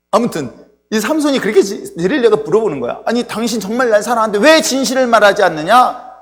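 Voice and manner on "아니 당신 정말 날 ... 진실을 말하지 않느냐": high, loud, indignant demand; imitated anger. Preacher voices Delilah confronting Samson, angrily. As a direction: act out an angry lover confronting him. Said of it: "화를 내는 거예요".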